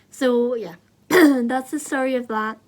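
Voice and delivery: hoarse voice. Real, not acted. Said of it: "Sorry if I sound like a dying cat".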